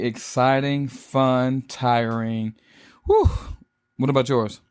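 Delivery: usual speaking voice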